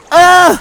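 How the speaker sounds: very high, loud shudder; shivering ugh. Acted. Floodwater lapping Percy's wheels, shivering ugh. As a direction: shudder as cold water laps. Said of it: "he shivered".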